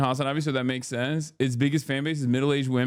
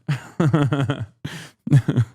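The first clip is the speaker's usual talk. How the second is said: laughter; 3 dB louder than usual. Real, not acted. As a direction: burst of staccato laughter. Laughs at own mockery.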